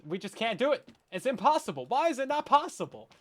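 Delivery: loud, rising mock despair; exasperated outburst. Venting at item frames that won't fit, exasperated outburst. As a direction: build to a high, loud, exasperated whine.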